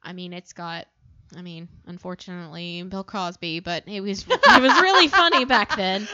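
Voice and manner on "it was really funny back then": awkward hedging. Real, not acted. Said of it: "I love the way you said that".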